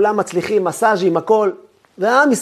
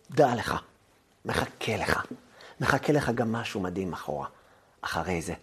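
tender reassurance; 8 semitones lower, 13 dB quieter than usual. Acted. Tender consolation to the listener.

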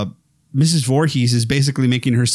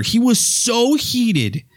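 mock outrage; 10 semitones higher, slower than usual. Retelling a critic's rage.

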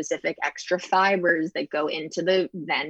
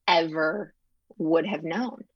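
usual speaking voice

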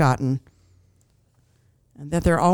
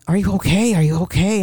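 retold concern, slightly raised and louder; concerned cashier; 3 dB louder than usual. Cashier to customer crying at checkout, concerned. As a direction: imitate a worried cashier checking on someone.

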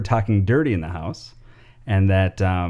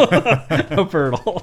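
slow laughing delivery; 7 semitones higher, much slower than usual. Real, not acted. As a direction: drag it out while laughing. Repeats joke word laughing.

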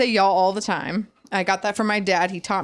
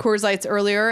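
normal talking voice

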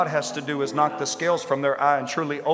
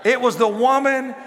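Adam blaming; 7 semitones higher, 4 dB louder than usual. Acted. Adam blames woman, acted.

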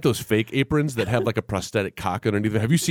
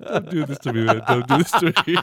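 laughing protest; 6 semitones higher than usual. Real, not acted.

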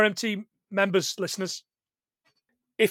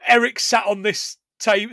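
ordinary talk

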